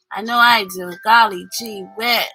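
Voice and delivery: ordinary delivery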